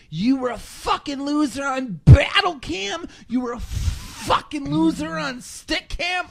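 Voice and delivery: high, slow, shouted mock insult. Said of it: "he's like".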